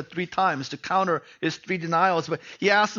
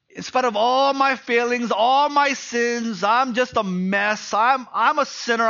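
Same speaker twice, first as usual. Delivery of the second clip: higher and louder, pouring out failings; impassioned confession; 6 semitones higher, 5 dB louder than usual. Retold or imitated. Peter confessing his failings to Jesus, impassioned. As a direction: plead it from the heart.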